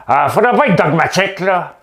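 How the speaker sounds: a hint of a talking-out-the-side-of-the-mouth politician. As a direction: talk out of the side of your mouth. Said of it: "c'est trop la bouche sur le côté".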